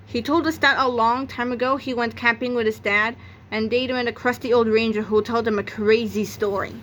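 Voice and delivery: ordinary delivery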